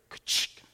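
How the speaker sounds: breathy ka-chick of the trap snapping; whispered trap snap. Retold or imitated. Trap snapping shut in the night, a whispered click. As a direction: click it out under your breath.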